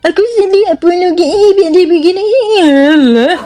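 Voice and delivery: high whiny mock sulking; mock whiny sad. (rengekan sedih yang diejek, nada tinggi)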